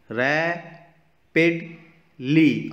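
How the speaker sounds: Rapidly split into three slow beats, रै, पिड, ली; syllable by syllable. Shown as a demonstration. Teacher showing kids the three sounds, rapidly broken into syllables. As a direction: sound out rapidly with a clear pause after each syllable. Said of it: "three sounds आ रहे हैं".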